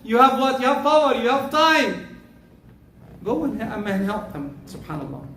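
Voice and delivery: ordinary delivery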